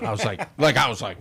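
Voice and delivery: ordinary talk, nothing marked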